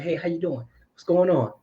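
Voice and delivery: high, friendly hello with a smile; easygoing, high-pitched cheery greeting; smiling greeting. Acted. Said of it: "that's why I'm always smiling".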